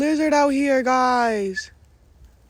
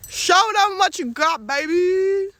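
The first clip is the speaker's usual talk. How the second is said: calling out; 6 semitones higher, 5 dB louder, slower than usual. Real, not acted. Calling out to boyfriend.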